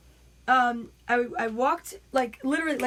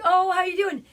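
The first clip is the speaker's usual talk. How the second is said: higher, louder friendly greeting; animated friend; 6 semitones higher, 5 dB louder than usual. Retold or imitated. Friend greeting the costumed gorilla, animated and high. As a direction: imitate a friend's bubbly hello. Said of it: "she's like".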